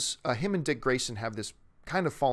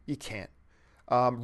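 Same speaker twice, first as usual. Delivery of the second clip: usual speaking voice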